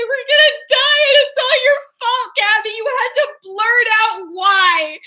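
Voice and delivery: very high, loud mock hysteria; panicked blaming. Girl blames her friend for being chased, mock hysterics. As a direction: wail the accusation in comic panic.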